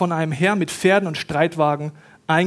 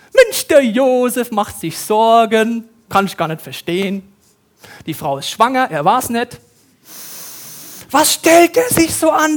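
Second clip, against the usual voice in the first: mocking know-it-all; 6 semitones higher, 5 dB louder than usual. Spöttische Sicht auf Weihnachtsgeschichte.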